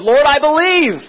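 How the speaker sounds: high, ringing plea; the blind man bursting out in belief; earnest cry of faith. Retold or imitated. Blind man confessing to Jesus, high earnest cry.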